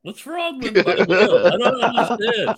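laughing protest. Real, not acted. [name] defends his meal, laughing.

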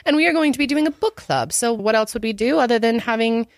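put-on British tea-party hostess; hammed-up British accent. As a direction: announce it in a playful British accent. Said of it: "we are being very British".

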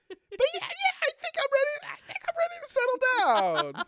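very high squeaky voice; mock her in a high squeak. Mimicking the contestant's answer.

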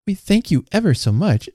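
put-on high, singsong boyish thanks; little boy. A narrator's reading. Hugh thanking the visitor, polite little boy. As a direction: thank politely as a small child would. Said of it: "said Hugh".

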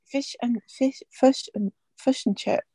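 tentative New Zealand accent; hesitant practice at a Kiwi accent; attempted Kiwi accent. Shown as a demonstration. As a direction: try out Kiwi vowels, feeling for them. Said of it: "in her best Kiwi accent".

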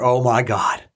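normal talking voice

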